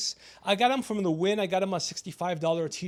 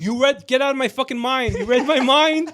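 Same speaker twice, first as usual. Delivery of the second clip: higher, much louder outburst; loud exclamation; 5 semitones higher, 10 dB louder than usual. Real, not acted. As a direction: exclaim loudly as if your thoughts were just read. Startled that friend guessed his thoughts.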